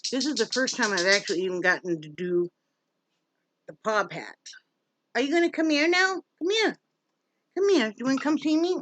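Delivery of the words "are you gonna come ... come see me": high, quick sing-song coaxing to a dog; pet talk. Real, not acted. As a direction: call the dog over sweetly, up high. Coaxing the poodle to come.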